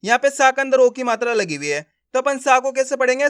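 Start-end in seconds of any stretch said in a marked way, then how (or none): none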